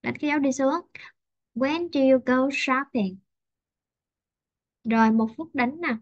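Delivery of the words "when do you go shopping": "When do you go shopping?" said slowly, stepping down to the end; slow and let it fall; slow falling wh-question tune. Shown as a demonstration. Teacher modeling a wh-question for the class, slow falling tune. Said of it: "mình đi xuống cho cô".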